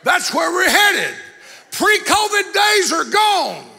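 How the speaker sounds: loud, high preaching shout; fiery preacher building to a roar; full-force preaching. Acted. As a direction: thunder it from the pulpit.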